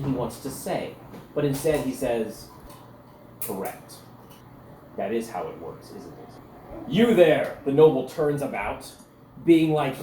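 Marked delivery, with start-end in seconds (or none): 3.4-6.9 low and softer, fading to a breath; quiet veiled menace. Acted. Cecil to Mephiquetzo, low quiet menace. 6.9-7.6 louder haughty summons; calling out. Acted. Arrogant noble calling to Cecil, loud.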